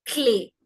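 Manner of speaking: clé said as "klay"; careful French model word, clé as /kle/; accented é sound. Shown as a demonstration. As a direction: clear closed "ay" ending clé. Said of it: "which gives me the sound A".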